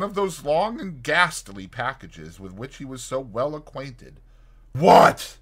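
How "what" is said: loud startled cry of alarm. Acted. Fetz at four a.m., finding McFarlane with a body: loud shocked cry. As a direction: blurt it out in shock. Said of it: "he cried".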